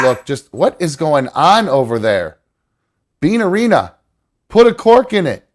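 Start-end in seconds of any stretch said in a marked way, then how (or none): 3.2-5.5 scolding the dog. Real, not acted.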